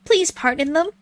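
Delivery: high-pitched scared little character voice; frightened villager. Acted. Reading a scared game character's line.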